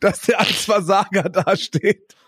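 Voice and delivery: high, slow, mocking disbelief; slow high sarcastic aside to a friend; incredulous inner voice. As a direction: drag it out, half laughing at the absurdity. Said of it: "Und ich denke mir".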